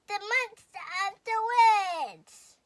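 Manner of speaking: high, loud and slow announcement; grand welcome. A narrator's reading. Owl welcoming the monster to the meeting, grand and loud. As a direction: present the guest with a flourish.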